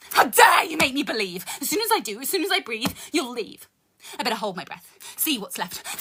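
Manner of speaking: rhythmic poetry recital with dark punchlines; spoken word poem. Acted. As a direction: perform it like a slam poem. Continues the poem.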